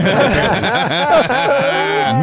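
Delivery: high, far-off cackle at himself; high burst of laughter. Real, not acted. Laughing at himself while retelling the Palin photo, high cackle. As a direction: laugh at your own absurd story. Laughing at his own story.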